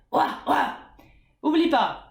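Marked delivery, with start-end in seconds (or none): 0.1-0.8 simple mid-pitch bark; medium dog bark. Shown as a demonstration.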